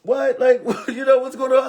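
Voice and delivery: laughing disbelief. Retold or imitated. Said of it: "And I'm like".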